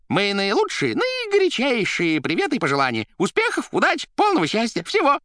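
high, loud effusive well-wishing; telephone figure's voice. A narrator's reading. The receiver sending warmest wishes, squeaky and gushing. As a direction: pile up good wishes in a squeak. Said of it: "говорила трубка".

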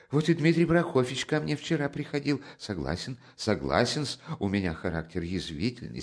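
high, rapid fussy chatter; fussing Porfiry. Acted. Porfiry chatters at Raskolnikov, high and fussing. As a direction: fuss and rattle on.

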